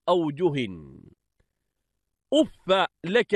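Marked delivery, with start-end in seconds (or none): 2.3-3.3 أف ending in a short "a", "uffa"; fatha on fa. Shown as a demonstration. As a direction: say أف as "uf-fa", doubled f then short a. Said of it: "بفتح الفاء".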